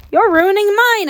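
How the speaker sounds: put-on girl screaming at the ghost mother. A narrator's reading. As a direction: scream back defiantly. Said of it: "I screamed".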